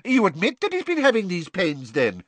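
high, quick pounce; quickly. A narrator's reading. Fudge pouncing on Dumbledore's admission, high and quick. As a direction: jump on the admission. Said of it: "said Fudge quickly".

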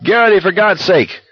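high, urgent yell of his name; high desperate yell at a collapsing friend. Acted. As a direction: shout him back to his senses. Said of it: "McBreeze was yelling".

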